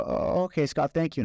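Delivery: high, small voice of a let-down customer; dumbfounded. Acted. Guest gives up on the milkshake with the clerk, dumbfounded. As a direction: act out a stunned, deflated reply. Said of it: "I am dumbfounded because that was my reward".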